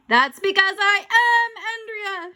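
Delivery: high, loud, slow ghostly shout. A narrator's reading. Ghost reveals herself to the boy, triumphant shout. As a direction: shout it out, drawing the words long. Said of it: "she shouted".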